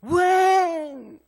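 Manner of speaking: stretched, booming mock animal roar; cat's roar. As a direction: roar at the little cub like a giant cat. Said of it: "고양이 이러는 거예요".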